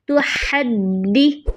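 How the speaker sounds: slow तो हद्दी with lots of breath on the ह; breathy ha demo. Shown as a demonstration. Teacher demonstrates a breathy ह in हद्दी. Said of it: "सांस खूब बाहर निकालना है".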